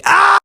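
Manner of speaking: very high, loud shriek; sudden scream. Acted. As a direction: burst into a scream after holding back. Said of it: "I'm not gonna scream".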